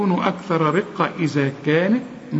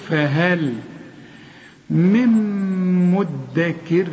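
recited verse; much slower than usual. Shown as a demonstration. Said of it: "اتعلم من القرآن الحروف".

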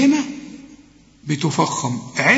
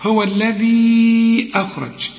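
الذي with the final "ee" held long on one note; four-count elongation; 3 semitones higher, slower than usual. Shown as a demonstration. Teacher demonstrating the madd before hamza, الذي held long. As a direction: slowly stretch the end of الذي before أخرج. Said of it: "بنمد أربع حركات".

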